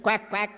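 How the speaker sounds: playful imitated quacking; duck quacking. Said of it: "He said".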